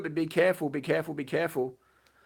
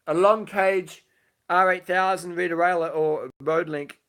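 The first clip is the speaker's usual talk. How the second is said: ordinary talk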